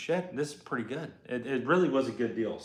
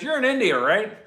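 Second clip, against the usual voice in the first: quoted woman; 9 semitones higher, 6 dB louder than usual. Retold or imitated. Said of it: "she goes".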